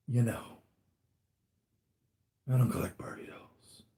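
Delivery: breathy whispered aside, no voice. Real, not acted. Collector whispering an aside to his viewers. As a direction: whisper it to yourself in protest.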